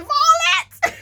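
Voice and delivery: very high shouted name with laugh; manager yelling name. Retold or imitated. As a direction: call her name out in a shriek.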